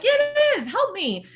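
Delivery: high, loud pleading cry; desperate plea. Retold or imitated. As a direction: insist it's serious. Pleading with roommate.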